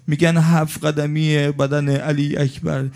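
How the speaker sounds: high, keening lament; mourning lament. Acted. Preacher laments Hussein's fall to the mourners, high keening. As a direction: wail the scene for the mourners.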